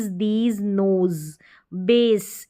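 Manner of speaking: ordinary talk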